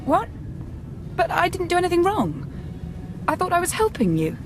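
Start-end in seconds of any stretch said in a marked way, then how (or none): 1.2-3.7 high-pitched, dismayed protest; alarmed protest. Acted. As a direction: plead innocence in a raised pitch. Startled protest when told of surveillance.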